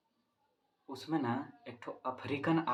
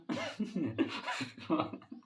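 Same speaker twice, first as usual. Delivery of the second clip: giggle fit; 5 semitones higher than usual. Real, not acted. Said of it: "हमको पहले ही हंसी आ रही".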